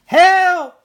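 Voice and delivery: very high, very loud terrified scream. A narrator's reading. Brother waking from nightmare, terrified scream. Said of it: "he screamed".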